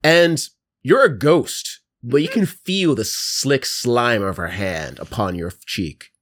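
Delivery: normal talking voice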